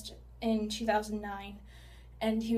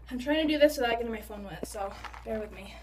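ordinary talk

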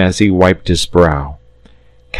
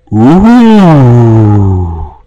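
louder, higher crowd ooh of wonder; awed ooh; 4 semitones higher, 6 dB louder than usual. Acted. Crowd marvelling at the dragon, loud awed ooh. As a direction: gasp it out like at a rocket.

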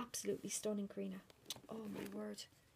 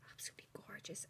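whispering; 11 dB quieter, much faster than usual. Real, not acted. Admiring a gift.

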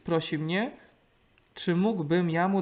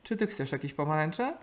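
ordinary talk, nothing marked

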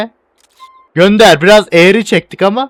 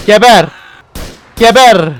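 loud barked curse at enemies; fierce yell; 3 semitones higher, 4 dB louder than usual. Real, not acted. Gamer yelling at enemies while firing. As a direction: spit it out while shooting.

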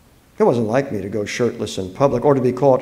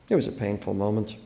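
ordinary delivery

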